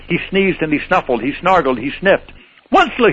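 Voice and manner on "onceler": high croaky call of the name; croaking cry. Acted. Lorax calling out to the Onceler, croaking cry. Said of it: "he cried with a cruffulous croak".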